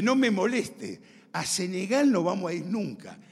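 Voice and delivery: imitated firm brush-off; forceful refusal. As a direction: refuse flatly, acting out a strong character. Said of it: "era un carácter fuerte".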